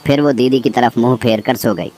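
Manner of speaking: ordinary delivery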